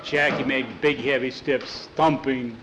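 ordinary delivery, nothing marked

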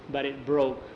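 plain reading voice

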